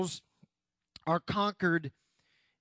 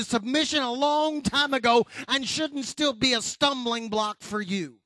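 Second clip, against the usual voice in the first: raised, driving preacher's voice; forceful preaching; 6 semitones higher, 5 dB louder than usual. Real, not acted. Preacher rebuking congregation for old hang-ups, forceful preaching.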